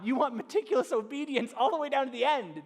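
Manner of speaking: ordinary talk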